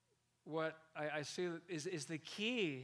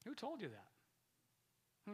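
much slower and softer, letting it sink in; slow, soft repeat; 5 semitones higher, 7 dB quieter, much slower than usual. Shown as a demonstration. Preacher repeats the Spirit's question slowly and softly for the crowd. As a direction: draw the question out quietly. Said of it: "repeats the question slowly to reflect".

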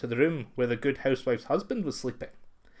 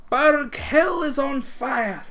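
high, slow, loud call from a hilltop; crying out with all her might; 10 semitones higher, 5 dB louder, much slower than usual. Acted. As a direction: shout it out long and slow across the hill. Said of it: "cried three times with all her might".

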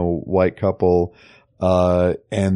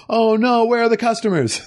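much higher, mock worried; high mock dismay; 13 semitones higher than usual. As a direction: squeak it in fake alarm. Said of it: "Carla said".

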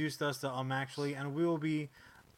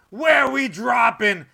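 loud, higher, drawn-out battle cry; hype shout; 7 semitones higher, 13 dB louder, slower than usual. Acted. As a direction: yell it like a gamer's rally call. Sponsor read catchphrase, shouted.

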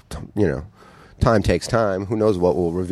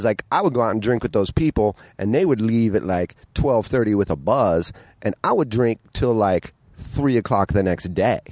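normal talking voice

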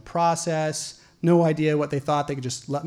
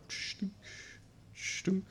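soft imitated thud of pages; page thud sound; 4 semitones higher, 18 dB quieter than usual. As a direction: mouth the sound of a code book page. Imitating book pages flipping.

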